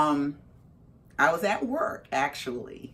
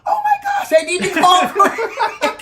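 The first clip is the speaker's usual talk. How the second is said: very high, loud squeal; frantic realization; 13 dB louder than usual. Retold or imitated. Remembering she must call her husband, frantic. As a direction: shriek it through laughter.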